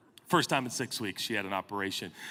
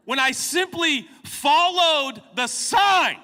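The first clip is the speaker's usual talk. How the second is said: slow, loud, much higher outrage; indignant shout; 12 semitones higher, 8 dB louder, much slower than usual. Real, not acted. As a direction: drag out every word in mock disbelief. Indignant punchline to the audience.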